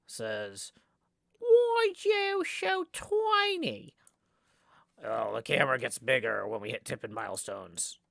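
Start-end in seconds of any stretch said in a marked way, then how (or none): 1.4-3.9 high, loud, slow yell in a put-on voice; yelling character voice. Streamer yelling a viewer's chat message in character. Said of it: "do roughly that voice for every single person".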